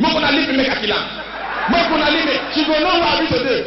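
hammed-up threats while losing the fight; fake tough shouting. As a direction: pretend you're being held back. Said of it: "Now I start to dey ad lib".

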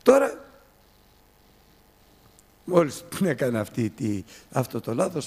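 usual speaking voice

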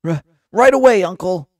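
loud, high, stammered reply; acted hasty high-pitched compliance; flustered underling. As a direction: jump to obey with a high yelp. Underling answers a shouted order.

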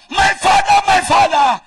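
high, loud imitation of frantic prayer; hammed-up congregational prayer shout; mock shouting. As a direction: mimic clueless believers shouting their prayers. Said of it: "they shout".

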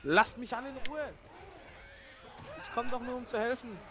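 high frantic yell; panicking gamer. Real, not acted. Swarmed while trying to help.

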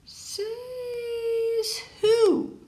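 put-on high childlike character voices; high excited kid voices in a story. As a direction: play the kids and sign kids eagerly. Sign voice retort.

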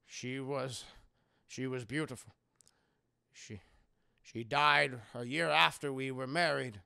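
slow, halting, subdued recollection; grieving widower. Acted. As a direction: tell it heavily, with long pauses. Widower recalls his late wife.

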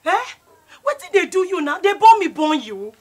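loud, high demanding shout; angry confrontation. Acted. Lucy shouting at Nene in a quarrel. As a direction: confront her loudly.

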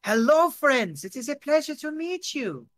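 put-on overjoyed foreigner, German-French accent. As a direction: gush hello in a hammed-up German-French accent. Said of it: "he's switching between German and French".

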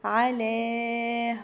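على with a long held final vowel; four-count madd. Shown as a demonstration. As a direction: stretch على for four beats. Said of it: "مد أربع حركات". (مد أربع حركات في على)

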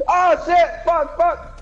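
high, loud frantic cursing; real game panic; panicked swearing. Real, not acted. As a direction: curse in alarm while fleeing. Fleeing killer in game.

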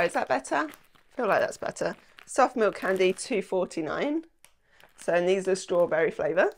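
ordinary talk

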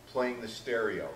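ordinary talk, nothing marked

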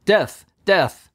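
high, repeated cry of doom; heralds' shout. A narrator's reading. Heralds echoing the sentence of doom, high repeated shouts. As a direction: hammer each death like a bell. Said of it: "they shout".

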